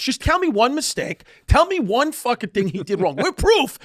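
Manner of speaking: high, insistent mock rant; heated challenge. Funeral director challenging the mourners on Trump, heated. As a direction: imitate an indignant man demanding an answer.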